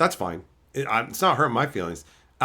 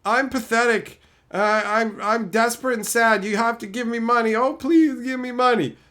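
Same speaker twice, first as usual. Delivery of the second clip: higher, whiny pleading of a desperate beggar; mock pathetic beggar; 9 semitones higher than usual. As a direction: whine it like you're begging for scraps. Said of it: "I don't sit there going".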